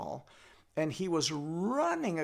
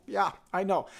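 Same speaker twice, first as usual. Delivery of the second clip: ordinary delivery, nothing marked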